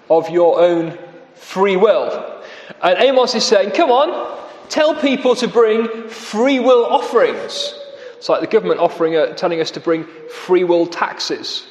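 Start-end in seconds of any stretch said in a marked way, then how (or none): 3.7-7.7 high, scoffing come on; mocking. Amos mocking forced free will offerings, scoffing. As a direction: scoff at them with raised pitch. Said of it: "he really mocks them".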